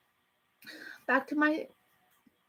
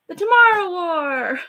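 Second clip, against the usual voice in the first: big loud title call, pitched up; excited announcement; 5 semitones higher, 8 dB louder than usual. Acted. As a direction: announce the movie title like a trailer. Announcing the video's topic.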